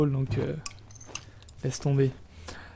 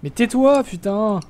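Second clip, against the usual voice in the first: loud; 5 semitones higher, 5 dB louder than usual. Real, not acted. Playful outburst at friend.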